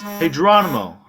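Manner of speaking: high, loud call through a door; retold yell. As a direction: shout it at the door.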